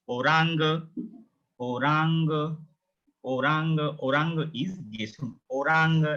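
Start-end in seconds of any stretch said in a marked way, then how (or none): 0.1-3.8 "Orang" as oh-RAHN-guh; German pronunciation. Shown as a demonstration. Teacher drills the German O word, German pronunciation. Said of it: "Same like English". 5.5-6.1 "Orang" as oh-RAHN-guh; German pronunciation. Shown as a demonstration. Teacher models the German colour word, German pronunciation. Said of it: "It's orange".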